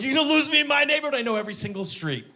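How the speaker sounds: fast, high, loud yelling; incredulous taunt. Acted. Driver chasing man in his neighborhood, incredulous taunting shout. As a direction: rattle it off as a shouted challenge.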